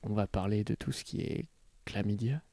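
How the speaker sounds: low, soft ASMR murmur. Retold or imitated. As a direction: hush it like an ASMR video. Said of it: "un mix entre un podcast et de la S M R".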